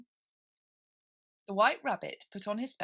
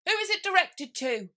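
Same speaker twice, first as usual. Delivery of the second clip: very high, loud questioning voice; squeaky juryman; 13 semitones higher, 8 dB louder than usual. Acted. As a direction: ask like a fussy little juror. Juryman asks.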